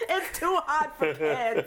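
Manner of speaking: slow, high put-on voice; playful character voice. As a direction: voice the pantless guy. Voicing the listener.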